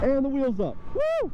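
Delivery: high whoop of joy; excited. Real, not acted. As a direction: cheer as the wheel lifts. Wheelie at speed.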